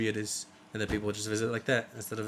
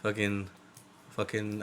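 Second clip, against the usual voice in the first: ordinary talk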